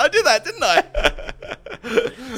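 high, loud, breaking into laughter; amused, drawn-out confession; laughing admission. Retold or imitated. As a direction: own up with a big laugh. Laughingly owning up to insult.

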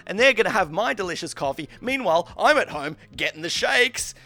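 high-pitched, agitated retold complaint; re-enacted worked-up grievance; exasperated rant.

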